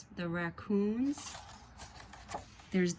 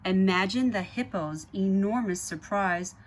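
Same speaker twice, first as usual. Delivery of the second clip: put-on lively read-aloud to children; story narration; 6 dB louder than usual. A narrator's reading. Reading the Zoobrary book to kids, lively narration. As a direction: read it like a bedtime picture book.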